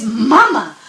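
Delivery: little cub calling down to his mother, excited. A narrator's reading. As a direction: call out to mama, thrilled. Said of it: "and says".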